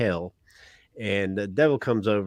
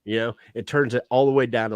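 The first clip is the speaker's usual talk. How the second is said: normal talking voice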